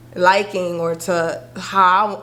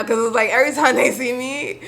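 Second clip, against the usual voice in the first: normal talking voice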